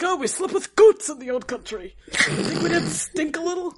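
lightly voiced old Yiddish grandpa; dismissive old-country grandpa; Boris imitation. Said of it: "he was like".

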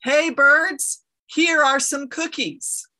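high, loud shout to the birds. A narrator's reading. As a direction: call out loudly to the birds. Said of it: "He shouted in a loud voice".

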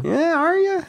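squeaky mocking question; teasing high voice. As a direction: pitch it way up, teasing.

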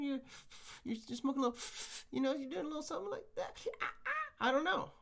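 usual speaking voice

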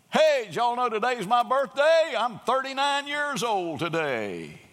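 loud, high, pleased announcement; brash birthday boast. Retold or imitated. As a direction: call it out across the room. Man announcing birthday loudly.

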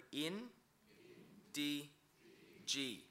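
in, D, G spoken one syllable at a time with pauses. Shown as a demonstration. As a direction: break in, D, G into slow separate syllables. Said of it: "So, Indigi".

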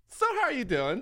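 high, sing-song barista greeting; mock chipper barista. Friend mimics the hyper barista greeting, high and chirpy. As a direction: squeak it out like an overeager barista.

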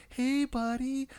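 exaggerated gentle father to toddler; sweet parent voice. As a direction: switch to sugary dad voice. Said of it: "I'm like".

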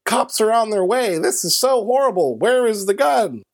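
high-pitched fretting homeowner. Retold or imitated.

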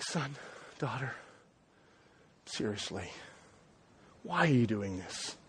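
soft, low, hushed parent after a fight; quietly, like mom and dad once everyone's settled down; gentle parental plea. Retold or imitated. Parent to child after a fight, soft and hushed. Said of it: "this is the attitude".

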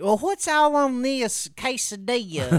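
high put-on voice for the aunt; imitating aunt. Retelling aunt ordering food, high mimicking voice. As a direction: mimic a confused relative ordering. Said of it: "she's like".

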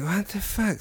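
quiet stunned curse; muttered disbelief. Retold or imitated. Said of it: "and I was like".

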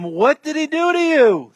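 high, exasperated demand; acted incredulous interrogation; insistent questioning. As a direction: question him with rising pitch, pressing for an answer. Questioners badgering the healed man.

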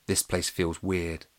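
ordinary delivery

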